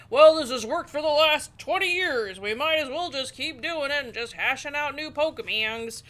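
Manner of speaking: loud, put-on mocking voice; hammed-up impression of someone stuck in their ways; complacent game maker. Player mocking makers of stagnant games, complacent put-on voice.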